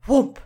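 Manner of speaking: loud whoomp; whoomp sound effect. Acted. Reader voices the head hitting the ground, loud whoomp. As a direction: knock it out.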